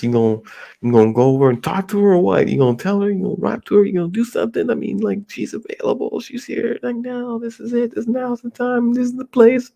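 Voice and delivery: pushy pal pressing harder and climbing in pitch each line; friend egging him on. Retold or imitated. Friend urging him to talk to the girl, pitch climbing. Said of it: "he's like".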